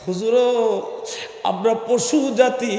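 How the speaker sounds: acted lament, halting between phrases; tearful pleading lion. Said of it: "কাঁদতেছে আর বলতেছে".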